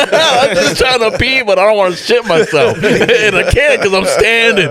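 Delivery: talking through laughter; giggling while telling it; laughing. Real, not acted. Laughing through the toilet story.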